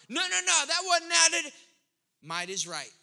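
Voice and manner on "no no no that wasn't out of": high, loud mock protest; acted courtside objection, pitched up; louder, arguing over someone. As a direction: cut in and overrule them loudly. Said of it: "because you're louder".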